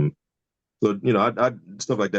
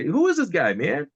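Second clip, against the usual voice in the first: high, curious imitation of a proper-talking lawyer; imitating the lawyer; 8 semitones higher, slower than usual. Lawyer asking the DJ about the comedian, in a high voice.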